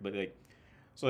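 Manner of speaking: usual speaking voice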